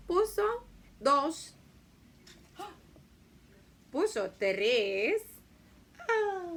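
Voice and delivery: high, loud, drawn-out counting with suspenseful pauses; dramatic children's rhyme. Shown as a demonstration. Teacher performs the hen poem for little kids, playfully dramatic. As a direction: perform each egg as a slow, big surprise.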